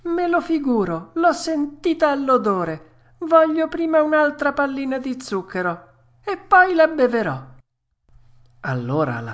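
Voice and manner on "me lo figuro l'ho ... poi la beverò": high-pitched puppet, bargaining; Pinocchio, stubborn and wheedling. Acted. Puppet bargaining for sugar, high insistent whine. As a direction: haggle like a spoilt child, pitched way up.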